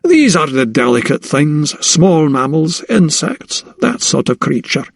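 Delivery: friendly Scottish brogue of a sea captain; affable Scottish brogue. Acted. Captain welcoming the doctor aboard, affable Scottish brogue. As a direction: roll it out in a genial Scottish accent.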